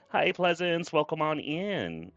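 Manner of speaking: high, slow sing-song welcome; cute greeting. Real, not acted. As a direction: greet a viewer brightly. Greeting a viewer in chat.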